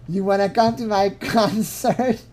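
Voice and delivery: imitating someone holding back laughter on a question; about to laugh. Mocking a girl's reply to a concert invitation, stifling laughter. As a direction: ask it while trying not to crack up. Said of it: "when you're about to laugh".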